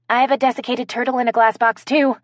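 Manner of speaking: fast, high, eager blurt. Acted. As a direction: rush it out eagerly. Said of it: "Kaylee blurted out".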